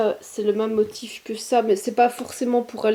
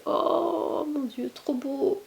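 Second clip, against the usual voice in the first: swooning whisper; 7 semitones higher, 4 dB quieter, much slower than usual. Real, not acted. Swooning over the soft fabric.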